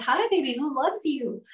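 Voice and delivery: high, singsong doting pet voice; sweet baby talk, pitched high; affectionate puppy talk. Retold or imitated. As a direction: coo to a puppy you adore. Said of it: "loves it when I tell him".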